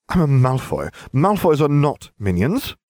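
high-pitched, affronted protest; indignant. A narrator's reading. Malfoy refusing to be Harry's minion, indignant. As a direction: sputter it with wounded pride. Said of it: "Malfoy sputtered in indignation".

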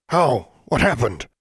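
high, loud panicked questions; alarmed. A narrator's reading. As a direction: ask in sudden alarm. Men alarmed by the death.

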